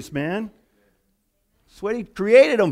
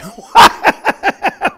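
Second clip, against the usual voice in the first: mocking laugh; 11 semitones higher, 10 dB louder than usual. Mocking laugh at his idea.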